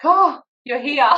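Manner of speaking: high, loud, gushing welcome; imitated delighted relief. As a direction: greet a rescuer as if heaven-sent. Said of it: "like this angelic person had just come in".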